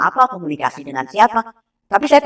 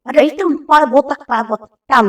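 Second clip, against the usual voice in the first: put-on small child's report; 7 semitones higher, faster than usual. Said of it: "karena ya anak kecil cuma ngomongnya hitam".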